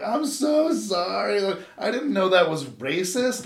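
imitating his younger self near tears; retold crying apology. Kid apologizing to the principal, mock tearful. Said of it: "And I'm like, crying".

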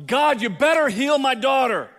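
loud, high, bossy demands; demanding entitled prayer. Retold or imitated. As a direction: order God around. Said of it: "And then we go".

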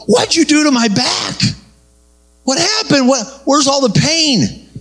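high-pitched acting out of amazement; retold astonishment. Healed man asks what you did to his back, astonished.

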